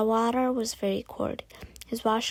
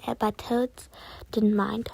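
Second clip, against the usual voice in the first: ordinary delivery, nothing marked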